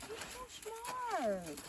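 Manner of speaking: high, drawn-out coo; cooing praise. Real, not acted. Rider praising her clever horse, cooing praise. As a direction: stretch it out high and adoringly.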